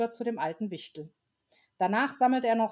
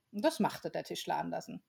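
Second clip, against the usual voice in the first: plain reading voice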